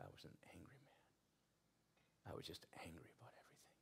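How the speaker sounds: whispered confession, barely voiced; hushed, intimate retelling of his words.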